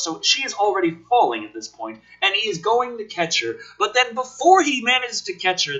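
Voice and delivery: ordinary delivery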